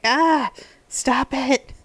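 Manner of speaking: loud, high, drawn-out mock protest; retold emotional outcry. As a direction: cry out slowly as if it's too much. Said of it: "I almost cried again when they showed Rallis".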